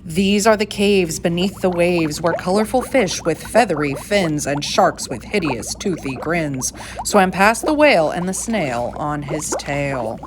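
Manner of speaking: ordinary delivery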